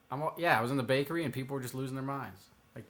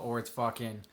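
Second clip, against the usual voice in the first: usual speaking voice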